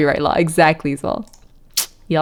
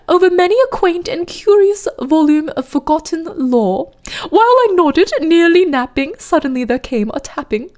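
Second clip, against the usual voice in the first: fake posh Southern accent; 7 semitones higher than usual. Streamer testing The Raven for chat, in fake posh Southern accent. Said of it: "a fake, like, posh Southern accent".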